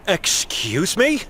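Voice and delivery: slow, rising question of disbelief; taken-aback excuse me. Acted. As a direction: let the me climb, offended. Shocked at being called damaged.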